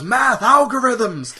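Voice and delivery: high, loud, slow mock yell; Stanley Tucci yell. Stanley Tucci yelling about math at the heroes. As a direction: bellow it like a frantic scientist. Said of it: "Stanley Tucci goes".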